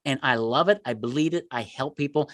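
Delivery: ordinary talk, nothing marked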